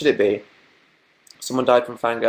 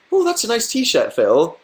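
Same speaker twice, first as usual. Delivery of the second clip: Perry's compliment; 8 semitones higher than usual. Retold or imitated. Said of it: "then Perry says".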